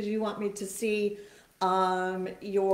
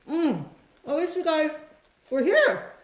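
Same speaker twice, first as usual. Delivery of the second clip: talking through a mouthful of waffle; words round a bite of food; mouth full; 2 semitones higher than usual. Real, not acted. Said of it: "Don't talk with your mouth full".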